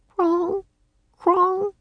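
high, ringing raven notes; soft bell-like bird call. A narrator's reading. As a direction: chime it like a bird. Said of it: "Rock made soft bell-like sounds".

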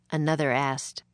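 ordinary delivery

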